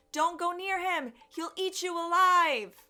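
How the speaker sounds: high, loud cry stretched out at the end; yelled warning. A narrator's reading. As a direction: shout them back from the giant. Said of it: "People yelled".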